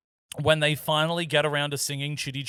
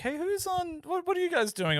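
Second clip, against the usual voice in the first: high-pitched eager network rep voice; mock SBS caller; 12 semitones higher, 5 dB quieter than usual. SBS checking in on the podcasters, high mock-network voice. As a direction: lightly imitate a nosy broadcaster, pitched right up. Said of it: "S B S is constantly like".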